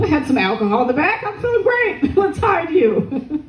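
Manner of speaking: high, giggly, over-eager agreement; mock tipsy enthusiasm. Coworker tipsily agreeing to hide the man, hammed-up enthusiasm. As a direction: play up boozy cheer, pitched well up.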